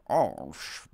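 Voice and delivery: brief mock-posh English vowel; posh accent. Shown as a demonstration. Streamer showing chat what a posh accent is, posh accent. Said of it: "I know what a posh".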